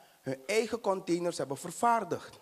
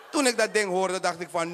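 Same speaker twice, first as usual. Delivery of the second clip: ordinary talk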